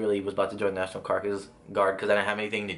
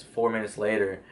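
usual speaking voice

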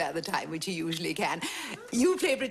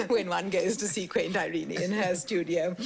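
ordinary talk

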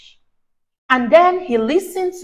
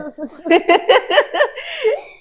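mocking laugh; 10 semitones higher than usual. Fish laughing at Terence.

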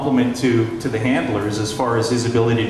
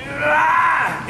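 mock goofy yelp. Imitating premier's goofy outburst.

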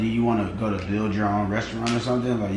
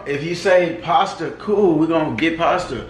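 heated; 6 semitones higher, 6 dB louder than usual. Real, not acted. Arguing point loudly.

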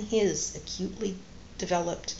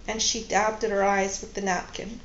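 usual speaking voice

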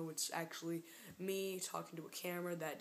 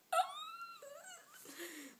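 high thin held squeal; comic high-pitched whine; mock wail. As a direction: let out a long fake cry. Mimed wail after mocking exchange.

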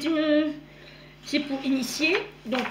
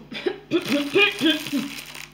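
coughing fit with a laugh; 2 semitones higher than usual. Real, not acted. Sore throat while recording.

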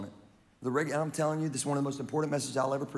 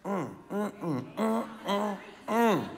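humming; 5 semitones higher, 3 dB quieter, slower than usual. Real, not acted. Savoring agreement after point.

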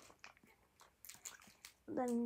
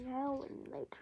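whispering; 2 semitones higher, 5 dB quieter than usual. Real, not acted. Whispers candy name.

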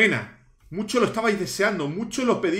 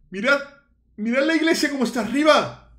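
ordinary delivery

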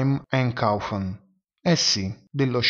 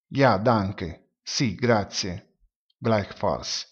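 usual speaking voice

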